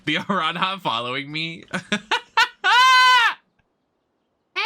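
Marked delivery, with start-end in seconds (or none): 1.7-3.3 loud, very high-pitched laugh; piercing burst of laughter. Real, not acted.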